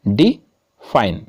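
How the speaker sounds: "Define" split in two, a pause after "डि"; syllable by syllable. Shown as a demonstration. Teacher showing students two syllables, "define" slowly split apart. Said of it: "कितने खंडों में हम किसी शब्द को उच्चारित करते हैं".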